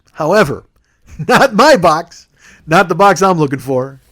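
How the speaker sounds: slow, deliberate over-explaining; measured start building to loud exasperation; exasperated patience. Retold or imitated. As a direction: drag it out, then punch the correction loudly. Exasperated customer correcting confused clerk.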